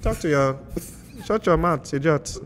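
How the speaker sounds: put-on loud, high Nigerian-accented scolding; mock-angry Nigerian accent. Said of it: "His accent is so horrible".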